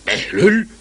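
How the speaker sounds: sharp, high startled question; surprised. Acted. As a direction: caught off guard by the name. Governor startled by the name.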